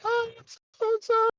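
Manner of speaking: very high, drawn-out mock female voice; exaggerated falsetto introduction; nurse's greeting. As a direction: chirp it in a squeaky falsetto as the nurse. Imitates the nurse introducing herself.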